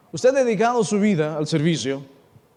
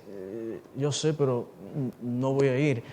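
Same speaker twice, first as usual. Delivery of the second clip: low, softer, understated reply; calm refusal; 9 semitones lower, 7 dB quieter, slower than usual. Acted. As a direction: decline quietly, voice dropped. Voices Jesus calmly refusing.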